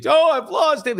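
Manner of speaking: high, whiny mock lament; mock whine. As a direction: play the person crushed by loss, hammed-up. Mocking someone who lost everything.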